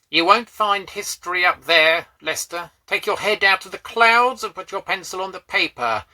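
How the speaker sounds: loud, high scolding whine; teacher's whine. A narrator's reading. Teacher scolding a daydreaming schoolboy, loud and whining. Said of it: "he whined".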